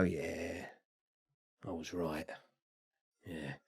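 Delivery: very low, hushed murmur to yourself; subdued self-justifying. Acted. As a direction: murmur it privately, with long pauses. Acts out lonely self-justifying at window.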